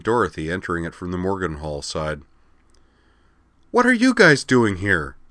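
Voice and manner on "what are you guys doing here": surprised outburst, higher and louder; blurted out. Acted. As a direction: blurt it out in shock. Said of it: "Wakaba blurted".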